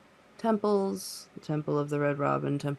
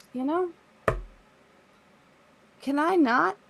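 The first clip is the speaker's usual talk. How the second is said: high, louder, faltering unease; visceral dread; 6 semitones higher, 3 dB louder, slower than usual. Acted. Gidget reacts to a bird temple, visceral high-pitched dread. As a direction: balk loudly at the idea, pitch climbing. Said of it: "very visceral reaction".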